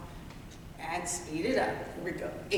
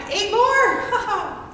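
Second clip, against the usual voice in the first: loud; 11 semitones higher, 8 dB louder than usual. Real, not acted. Instructor pushing final set.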